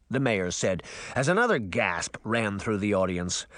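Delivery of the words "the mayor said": usual speaking voice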